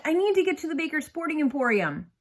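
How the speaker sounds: pleading. A narrator's reading. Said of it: "I said".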